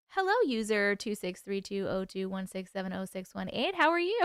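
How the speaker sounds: usual speaking voice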